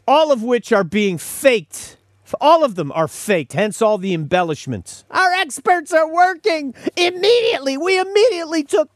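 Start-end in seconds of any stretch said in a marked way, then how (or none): 5.1-8.9 high and hammed-up PR voice; embellished corporate voice. Host mocks the inflated corporate statement, high and hammy. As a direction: oversell it like a fake-confident spokesman. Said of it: "they have to dress it up".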